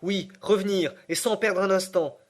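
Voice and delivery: insistent. Acted. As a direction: press hard, no delay. Nephew insists on going back.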